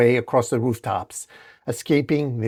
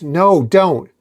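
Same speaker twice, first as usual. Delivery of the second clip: higher, loud cry; pleading scream; 6 semitones higher, 7 dB louder than usual. Acted. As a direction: scream at her to stop. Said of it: "Her father screams".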